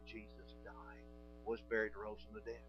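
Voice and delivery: slow, near-whispered, each phrase apart; slow, hushed gospel line. Shown as a demonstration. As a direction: breathe it out slowly and simply. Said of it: "opening your mouth and saying".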